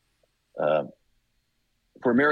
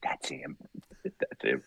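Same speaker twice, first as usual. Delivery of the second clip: hushed; 7 semitones lower, 7 dB quieter, slower than usual. Retold or imitated. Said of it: "It's like".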